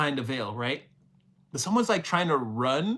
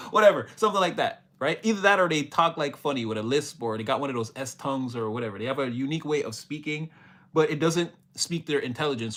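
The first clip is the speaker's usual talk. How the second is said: usual speaking voice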